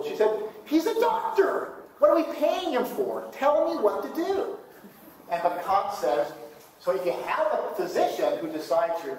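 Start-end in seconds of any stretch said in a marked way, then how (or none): 0.6-4.6 put-on old-school mother. Mother insisting the doctor decide, high and indignant. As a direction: squeak out her outrage at the doctor. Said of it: "my mother was old school".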